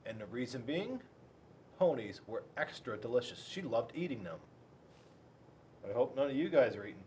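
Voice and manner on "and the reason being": ordinary delivery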